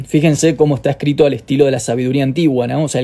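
ordinary talk

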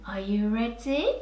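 ordinary delivery

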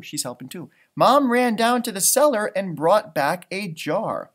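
ordinary delivery

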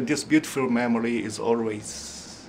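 ordinary talk

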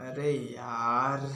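low, soft, drawn-out groan of words; weary exasperation. Acted. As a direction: give up wearily, dragging it out. Worn down by grandfather's endless questions. (धीमी, थकी हुई झुंझलाहट)

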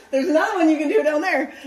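laughing through the words, quick and high; real laughter. Real, not acted. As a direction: giggle it out as you point. Laughing at short sister pulling tape.

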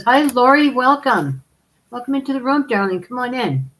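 high, bright hostess welcome; sweet welcoming voice. Pretend. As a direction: greet her like a gushing hostess. Sweet greeting voiced for a Virgo.

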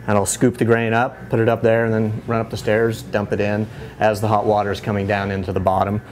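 ordinary talk

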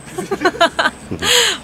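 high, loud burst of laughter; bubbly high giggling burst; real laugh. Real, not acted. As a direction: laugh out loud in a quick run. Speaker laughs after greeting.

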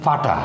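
ফাটা with an airy f opening; ফাটা, f in place of p; Sylheti pronunciation. Shown as a demonstration. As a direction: blow lightly into ফ of ফাটা. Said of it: "আবার এখানে আছে ফাটা".

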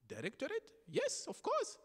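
high, surprised squeak of "Directorate?", then an eager yes; startled, then quick to accept. Retold or imitated. Accepting his manager's invitation to speak to everyone, surprised and high.

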